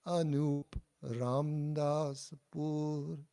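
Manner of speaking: slow teacher's demo of Anup Ramdas Pur; slow model phrase. Shown as a demonstration. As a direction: give Anup Ramdas Pur clearly for students to copy.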